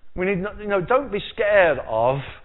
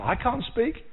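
I can't speak pitched up, pleading; mock Moses protesting, higher; whiny Moses; 7 semitones higher, slower than usual. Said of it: "That was his answer".

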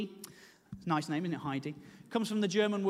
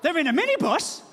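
mocking disbelief; 6 semitones higher, 3 dB louder than usual. Mocking the midwives' minibus.

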